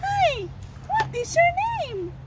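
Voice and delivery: very high puppet cow voice; squeaky-high toy voice; talking cow. Pretend. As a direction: greet a toddler as a tiny toy cow. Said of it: "make your cow talk".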